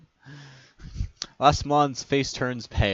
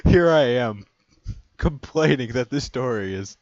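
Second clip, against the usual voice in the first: laughing; 3 semitones higher, 8 dB louder than usual. Real, not acted. Laughing at himself.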